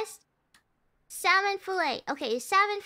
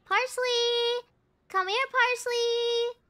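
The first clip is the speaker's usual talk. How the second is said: high, drawn-out sing-out; calling a pet; 4 semitones higher, slower than usual. Pretend. Player pretend-calling the missing parsley like a pet. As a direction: coax it over as if calling a lost pet.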